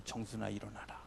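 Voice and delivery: soft whispered call to wife. Shown as a demonstration. Calling the wife to rise, in a whisper. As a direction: breathe her name tenderly. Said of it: "이 번은 물론 아내입니다".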